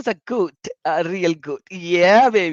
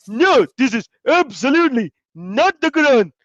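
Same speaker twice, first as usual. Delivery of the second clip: mock emphatic denial; 9 semitones higher, 6 dB louder than usual. Mimicking the Muslims' insistent denial, high and loud. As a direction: lean hard on every word like a stubborn crowd. Muslims answer in retold debate.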